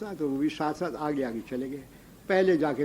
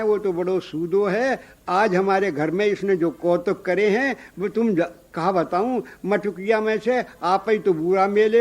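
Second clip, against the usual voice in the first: loud complaint; 3 semitones higher, 6 dB louder than usual. Acted. Gopi complains about butter theft.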